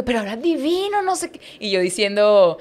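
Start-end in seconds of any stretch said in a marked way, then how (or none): 0.0-1.4 high, effusive imitation of an admiring family; gushing praise. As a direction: gush over the nervous newcomer, pitch way up. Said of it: "toda la familia como que".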